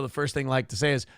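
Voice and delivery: normal talking voice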